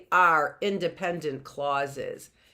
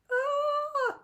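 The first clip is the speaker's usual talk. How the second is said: startled yelp. Real, not acted. Startled by something getting out.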